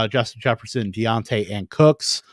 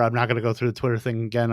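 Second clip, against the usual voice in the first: rapid-fire like an auctioneer; rushed; 5 dB quieter, faster than usual. Real, not acted. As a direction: rattle it off fast. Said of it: "I sound like an auctioneer right now".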